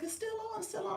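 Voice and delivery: high-pitched mock quote; squeaky-high imitation of onlookers; mimicking teasing viewers. Mimicking viewers telling on her.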